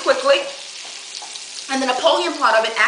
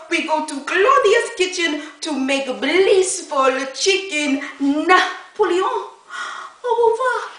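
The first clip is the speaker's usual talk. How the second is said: put-on Frenchie French accent. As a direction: overplay a comic French visitor. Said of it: "isn't that Frenchie a character".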